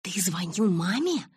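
slow, drawn-out horrified question; mild mock scream of dismay; understated horrified outcry; horrified cry. A narrator's reading. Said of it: "в ужасе закричала я".

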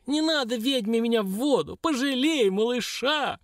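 high, pleading dwarf squeal; piercing creaky wail. A narrator's reading. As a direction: beg shrilly in a creaky little voice. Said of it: "ещё пронзительно скрипуче завопил карлик".

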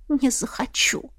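slow breathy whisper. Acted. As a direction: breathe the refusal out. Exhausted refusal, whispered.

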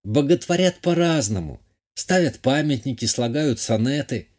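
high-voiced lady, playfully protesting; lightly put-on coquettish countess; coy countess.

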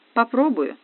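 ordinary delivery

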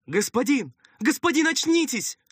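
high, loud urgent calling; frantic high-pitched plea to wake; anxious servant. Acted. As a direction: cry out to rouse an unconscious master. Servant calls to wounded master.

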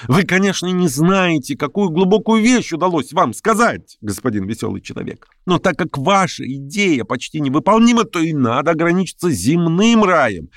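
high, earnest argument; heated, fervent. A narrator's reading. Shigalyov lectures the merry man, heated. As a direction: press the point fervently, pitched well up. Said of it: "горячо обратился Шигалёв к Лямшину".

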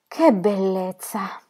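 slow, savoured delight; delighted exclamation. A narrator's reading. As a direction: relish the idea. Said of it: "esclamò Laura".

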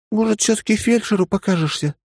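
put-on high, earnest young woman's voice; serious young woman. The lieutenant urges him again.

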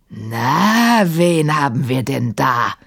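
drawn-out, swelling menace; booming djinn. A narrator's reading. Sahim spotting the intruders below him, booming. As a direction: boom it like a gloating giant. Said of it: "dröhnte er".

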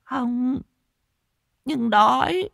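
put-on very high, drawn-out childlike voice; hungry waif, high and slow; simple-minded beggar girl. A narrator's reading. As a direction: plead slowly in a high, thin pitch. Mad beggar girl answers the owner.

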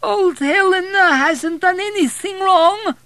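slow, very high, loud whine; whining old woman. A narrator's reading. Old Helena fearfully defending herself to visitors, whining. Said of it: "she said in a whine".

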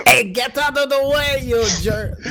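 high mock yell. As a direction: yell out of the way, cartoonish. Yelling at passerby.

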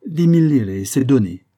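put-on loud Italian-accented price call; name your price boldly, as an Italian vendor; emphatic merchant, high start dropping away; Italian shopkeeper. A narrator's reading. Italian announces his price.

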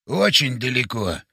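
low, drawn-out character voice; grave and unhurried; slow solemn reply. Acted. Old man's slow solemn answer.

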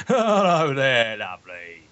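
high, slow, gleeful wheeze; the Hitcher's laughing voice. Retold or imitated. Podcaster imitates Mighty Boosh character, laughing voice. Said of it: "his voice constantly sounds like he's laughing".

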